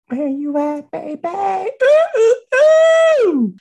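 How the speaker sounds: very high, loud wailing; high mock wail. High cry calling out.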